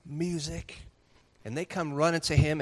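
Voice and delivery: ordinary talk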